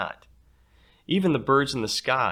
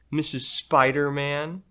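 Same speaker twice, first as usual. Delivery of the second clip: mocking girl; 6 semitones higher, 5 dB louder, slower than usual. Child mocks teacher's name.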